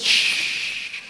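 whispered hush; shushing. Acted. Hushing the crowd.

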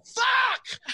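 sharp high shouted curse; startled yell. Retold or imitated. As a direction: yelp it out in shock. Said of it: "I yelled".

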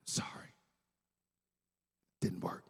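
hushed, low mock apology; discreet apology. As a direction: imitate a server quietly apologizing. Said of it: "they were trying to be discreet".

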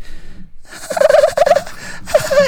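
very high returning cackle; haunting mock giggle; imitated laughter. Laughter he still hears, high-pitched cackle. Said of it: "he still hears".

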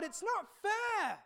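crying out to God. Retold or imitated. Believer crying out to God, slow anguished plea. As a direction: plead it with a breaking heart. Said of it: "we can be crying out to God".

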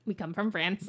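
a lightly voiced Conehead. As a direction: say it like a Conehead, deadpan. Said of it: "say that with the conehead voice".

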